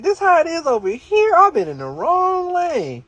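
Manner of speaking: stunned he'd missed this all along; amazed disbelief. Retold or imitated. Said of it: "I'm like".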